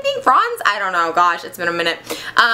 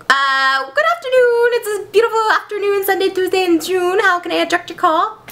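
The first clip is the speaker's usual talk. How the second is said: high, singsong sassy receptionist; Mister Carmagia; 7 semitones higher than usual. Acted. Sassy assistant picking up the phone, high singsong. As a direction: trill a campy phone greeting. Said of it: "he's a little sassy".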